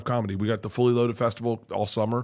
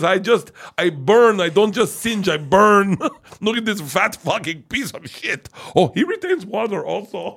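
a hammed-up, louder and higher Austrian action star, slow on the first words; a muscleman film star boasting, louder and pitched up, laughing through it; a hammed-up Austrian film star bragging about his roasting, louder and higher, breaking into laughter; horrible Arnold Schwarzenegger impression; 7 semitones higher, 4 dB louder than usual. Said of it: "I'm doing a horrible Arnold Schwarzenegger impression".

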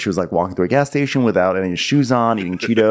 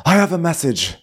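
mock-serious, higher-pitched messenger; 4 semitones higher than usual. Knight bringing the princess a message, all serious. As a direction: deliver it with solemn formality. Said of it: "he's all serious".

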